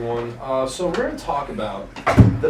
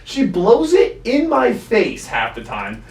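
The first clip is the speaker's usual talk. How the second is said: slow emphatic; 3 semitones higher, 3 dB louder, slower than usual. Real, not acted. Describing stepmom belching.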